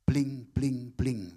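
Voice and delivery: playful sparkle noise, bling bling bling; bling sound effect. Pretend. Preacher mimics glittering sparkle for the congregation, playful. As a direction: mimic something shining to the congregation.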